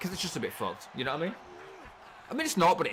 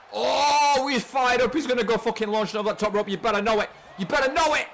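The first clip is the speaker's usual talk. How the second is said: loud, higher, pumped-up commentary; hyped commentary; 6 semitones higher, 4 dB louder than usual. Real, not acted. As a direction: sell the moment like a hyped announcer.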